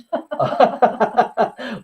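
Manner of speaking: short sheepish laugh at hiccups; embarrassed laugh. Real, not acted. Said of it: "I got the hiccups".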